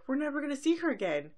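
high-pitched mock distress; distressed friends. Friends learning Twilight is leaving, high mock dismay. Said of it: "the other girls are like".

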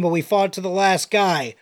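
ordinary talk

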